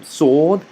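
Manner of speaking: Sod with a long, rounded O; heavy S and open O in Sod; rounded O sound. Shown as a demonstration. Teacher comparing two letters, rounded O in Sod. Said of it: "They have the O sound".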